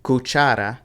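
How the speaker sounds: Cuchara as cu-CHA-ra; stress on second syllable. Shown as a demonstration. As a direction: lean on the middle syllable of Cuchara. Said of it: "you put the stress on the second one".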